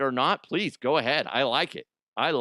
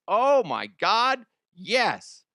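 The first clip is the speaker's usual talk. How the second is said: slow, loud, high gasp of joy; excited; 8 semitones higher, 6 dB louder, much slower than usual. Real, not acted. Excited ad read.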